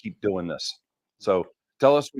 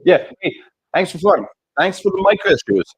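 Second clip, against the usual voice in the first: high, laughing, teasing thanks; 11 semitones higher, 9 dB louder than usual. Real, not acted. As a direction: still laughing while thanking.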